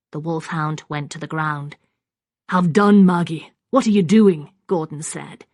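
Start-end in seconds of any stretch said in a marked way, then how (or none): 2.5-4.5 loud, sharp reprimand; sharp rebuke. A narrator's reading. Gordon stops Maggie striking Constance, sharp loud rebuke. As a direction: cut in loudly to stop her. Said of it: "At his sharp words".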